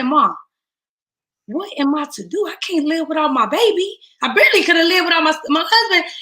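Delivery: mother begging her mom in the hospital room, anguished; desperate plea. Retold or imitated. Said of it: "I said".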